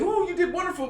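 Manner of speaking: high, gushing mock congratulations; mock praise. Husband mocking churchgoers applauding the dancer, gushing praise. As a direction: imitate an over-encouraging audience. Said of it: "y'all over here clapping and".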